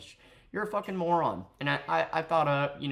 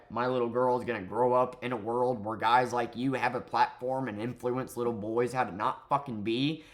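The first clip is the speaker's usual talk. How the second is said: ordinary talk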